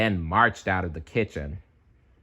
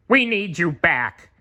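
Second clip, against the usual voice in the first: Squidward; 9 semitones higher, 3 dB louder than usual. A narrator's reading. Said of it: "Squidward said".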